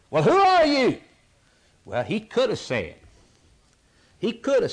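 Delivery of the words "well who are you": high, loud demanding question; questioners demanding. Acted. Priests questioning John the Baptist, high demanding shout. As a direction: press him for an answer. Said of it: "They came to John the Baptist and said".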